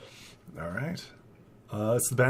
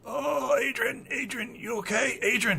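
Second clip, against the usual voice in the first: high-pitched, worried character voice; Billy; 13 semitones higher than usual. Acted. Friend shaking limp Adrian, high anxious voice. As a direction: fret over a fallen friend in a high voice. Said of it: "Billy's like".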